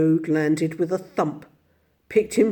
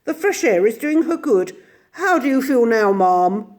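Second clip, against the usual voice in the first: high, louder kindly inquiry; solicitous gentleman; 6 semitones higher, 6 dB louder than usual. A narrator's reading. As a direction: fuss over the lady.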